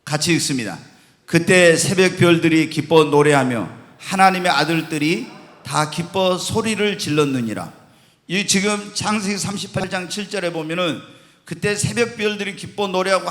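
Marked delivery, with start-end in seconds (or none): 1.2-7.7 loud, low congregational Bible reading; scripture reading. Acted. Preacher leads the congregation reading Job together, scripture reading. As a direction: lead a room in reading the verse aloud.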